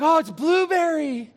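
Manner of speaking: Violet Beauregarde's voice. Retold or imitated. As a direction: play the spoiled girl tasting blueberry. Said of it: "And she's like".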